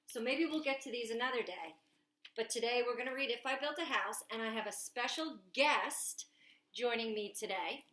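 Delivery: ordinary delivery, nothing marked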